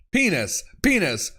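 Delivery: usual speaking voice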